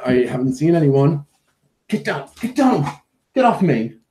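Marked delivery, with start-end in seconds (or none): 1.9-4.0 high-pitched mock scolding; feigned indignation. Owner pushing the dog off him, mock-indignant. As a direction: playfully order a pest off you. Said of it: "it's sort of feigned indignation".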